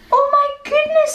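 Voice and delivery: put-on high, quick shocked little girl's voice; a girl's high surprised cry; girl's shocked gasp. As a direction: squeal it out like a startled girl. Girl gasps at seeing the cow.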